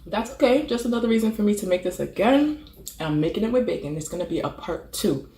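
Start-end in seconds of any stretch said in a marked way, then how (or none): 0.0-2.6 higher, louder cheerful bounce; bright self-consolation; upbeat. Real, not acted. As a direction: perk yourself back up. Cheers herself up.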